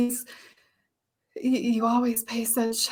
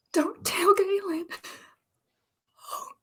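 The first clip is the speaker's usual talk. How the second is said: whispered plea, pushed higher; desperate whisper; 9 semitones higher, 4 dB louder than usual. Acted. Winnie begging Daniel in a whisper not to tell. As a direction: beg in a frantic whisper.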